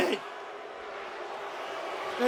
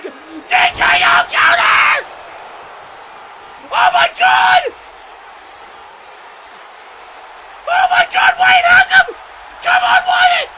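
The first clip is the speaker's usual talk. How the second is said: very high, very loud commentator scream; real full-throated shriek of excitement; ecstatic screaming; 7 dB louder than usual. Real, not acted. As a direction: scream your favourite wrestler on. Commentator erupts at a big move.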